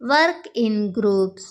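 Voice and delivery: very slow, clear classroom English; slow model phrase. Shown as a demonstration. Teacher modelling the phrase for Telugu students, slow and clear. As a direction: spell it out slowly for learners. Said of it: "అప్పుడు ఇలా అంటాం".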